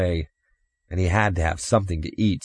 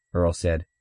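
ordinary delivery

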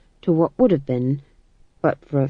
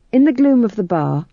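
ordinary delivery

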